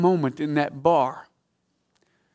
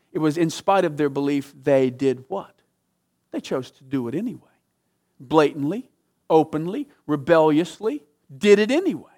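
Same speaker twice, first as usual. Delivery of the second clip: ordinary talk, nothing marked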